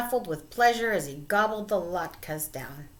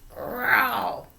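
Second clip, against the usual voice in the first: short, louder mock roar; bear roar; 4 semitones higher, 4 dB louder than usual. A narrator's reading. Bear roaring when his fur is tugged, mock roar. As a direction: give a playful bear roar. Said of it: "Old Bear roared".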